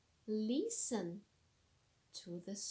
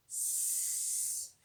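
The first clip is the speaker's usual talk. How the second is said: long unvoiced hiss; snake hiss. Shown as a demonstration. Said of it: "All together".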